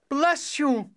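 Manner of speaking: high, loud royal exclamation; crying out. A narrator's reading. King to the cobbler's eldest son, crying out. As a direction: cry it out loudly as a grateful king. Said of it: "cried the king".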